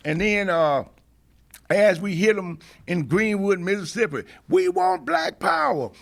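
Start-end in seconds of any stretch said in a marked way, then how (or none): 4.5-5.9 high, drawn-out re-enacted slogan; retold rallying cry. Activist recalling the march in Greenwood, high rallying cry. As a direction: pitch it way up like a slogan called to a crowd.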